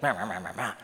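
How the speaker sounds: low, nonsense yammering; mock chatter. Preacher mimics someone talking in conversation, mock chatter. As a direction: imitate talk as meaningless "meh". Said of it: "You're talking, maybe it's, you know, like".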